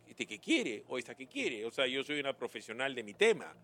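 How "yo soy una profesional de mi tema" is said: ordinary talk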